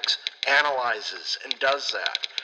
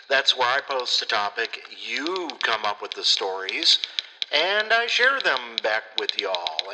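ordinary delivery

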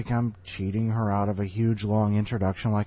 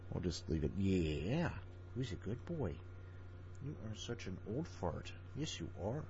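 soft, much quieter doggy talk; 5 dB quieter than usual. Real, not acted. A man talking to his old dog while recording, soft and cooing.

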